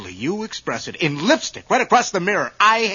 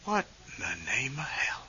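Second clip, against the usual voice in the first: hushed, breathy disbelief; stunned; 4 semitones lower, 12 dB quieter than usual. Acted. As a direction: whisper it under your breath, shocked. Finds message on the mirror.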